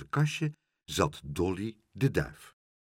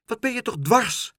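high, louder reproach; motherly exasperation, pitched up; exasperated mother; 13 semitones higher, 6 dB louder than usual. A narrator's reading. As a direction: scold a contrary child.

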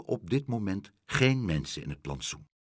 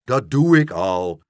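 loud protest without raised pitch; calling back; 10 dB louder than usual. A narrator's reading. Horse being hoisted, loudly calling back. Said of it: "riep Langhors".

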